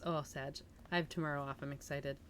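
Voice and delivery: usual speaking voice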